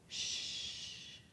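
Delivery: long, soft shush; retold soothing hush, drawn out. The Lord quieting the panicking speaker, hushing.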